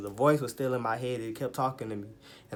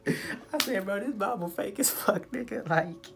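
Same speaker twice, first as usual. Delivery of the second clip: high-pitched, laughing disbelief; squeaky with amusement; 10 semitones higher, slower than usual. Real, not acted. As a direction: crack up while saying it, voice shooting way up. Cracking up at his own verdict.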